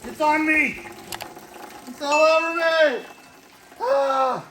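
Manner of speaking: high loud shriek. Real, not acted. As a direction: shriek as the muck hits you. Gunk splashes all over him.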